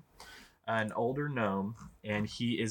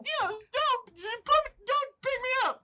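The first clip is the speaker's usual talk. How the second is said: very high, indignant gnome voice; high-pitched gnome; 4 dB louder, slower than usual. Acted. Gnome wriggled free and kicking, high-pitched protest at being picked up. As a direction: protest as a riled little gnome. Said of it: "a little bit of a higher pitched voice".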